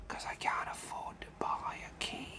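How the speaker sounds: hushed whisper; whispered aside. Real, not acted. Admitting to viewers he can't afford a key, whispered. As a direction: whisper it, barely audible. Aside about being short of money.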